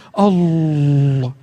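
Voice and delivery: Allah with the first syllable stretched; mock a reading that lengthens Allah; wrong long Allah. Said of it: "Di Allahnya dipanjangin".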